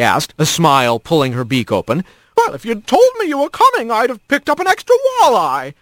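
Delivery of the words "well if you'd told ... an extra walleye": much higher, quick and fussing; a fond mom scolding lightly; cheerful mother hawk. Acted. Mother greeting her son at the door, high and bright.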